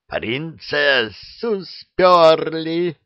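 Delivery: high, slow, stunned shout; shocked outcry. Acted. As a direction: realise the princess is gone. Koschei learns princess stolen. (потрясённо, протяжно)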